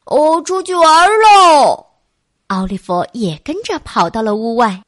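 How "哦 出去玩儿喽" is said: whooping call, stretched on the last word; excited shouting. A narrator's reading. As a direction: holler it like an excited kid running out to play. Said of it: "杰克嚷嚷着".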